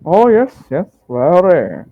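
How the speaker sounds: high, loud opening with swooping pitch; effusive merchant. Acted. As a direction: agree with showy relish. Merchant answers in character.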